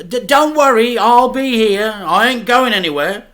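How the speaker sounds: reassuring dad. A narrator's reading. Dad reassures son, loud and cheery. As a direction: cover the worry with cheer.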